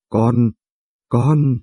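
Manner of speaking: halting, broken start; stammering. Acted. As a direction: trip over "con" twice, each cut short. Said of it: "Miệng lắp bắp". (lắp bắp sợ hãi)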